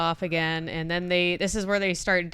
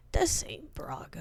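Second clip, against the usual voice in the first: whisper; 9 dB quieter than usual. Retold or imitated. Retold line, whispered.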